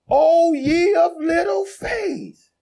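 voicing Jesus. Jesus rebuking disciples in the storm, slow ringing call. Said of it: "Let me say to you what he said to them".

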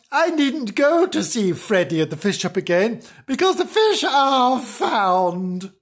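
high-pitched contented eagerness; cheerful character, drawing out the treats; cheering up. Acted. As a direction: relish the good news, pitch lifted. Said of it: "cheering up".

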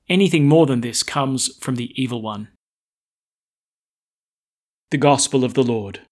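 loud, solemn scripture reading; gospel proclamation. Acted. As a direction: proclaim it to the whole church. Priest proclaims gospel aloud.

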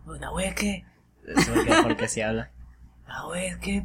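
ordinary talk, nothing marked

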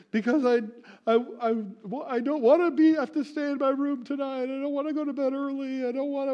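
put-on high, whining kid pleading against punishment; child protesting bedtime, high and whiny. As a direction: squeak it out like a sulky little kid. Parent voices crying child's excuse.

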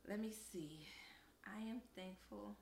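soft whisper to herself; whispered thinking aloud; whispered musing. Real, not acted. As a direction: murmur while thinking it over. Thinking aloud before thanks.

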